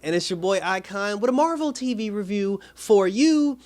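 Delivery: loud, high opening that settles into drawn-out words; show announcer. Acted. Host opens a Marvel TV review for viewers, announcer style.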